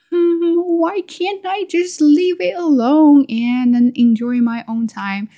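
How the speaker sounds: high, loud, drawn-out exasperation; mad at myself. Retold or imitated. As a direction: complain at yourself, slow and high-pitched. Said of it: "I get really mad at myself".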